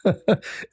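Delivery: a silent, airy laugh; breathy unvoiced laugh. Real, not acted. Still laughing about the debater named Abdul, airy silent laugh.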